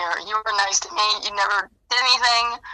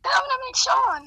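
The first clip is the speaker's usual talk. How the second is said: put-on much higher, a child's excited voice; imitating a little boy. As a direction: mimic the kid's sweet excitement. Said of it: "he's like".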